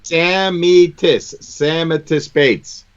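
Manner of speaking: Samitis said slowly, each syllable clear; sounding out words. Shown as a demonstration. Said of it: "He just sounded it out for you".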